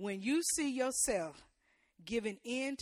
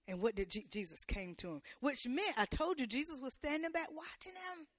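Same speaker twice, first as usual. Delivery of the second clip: ordinary delivery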